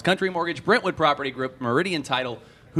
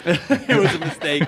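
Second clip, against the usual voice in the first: laughing while echoing back; amused chuckle through words; 4 semitones higher, 4 dB louder than usual. Real, not acted. Teasing echo of co-host.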